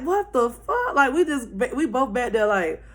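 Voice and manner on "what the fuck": higher, rising astonished echo; retold disbelief. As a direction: echo it, pitched up in shock. Friend echoes the stunned reaction.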